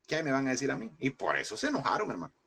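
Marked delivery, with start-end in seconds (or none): none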